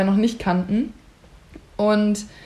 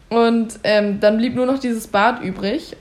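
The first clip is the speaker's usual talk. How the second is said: ordinary talk, nothing marked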